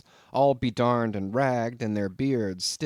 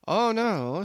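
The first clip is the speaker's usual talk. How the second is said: higher, louder teasing oh no; enjoy the joke on him; mischievous landlord; 10 semitones higher, 4 dB louder than usual. A narrator's reading. Landlord answers, diabolically funny.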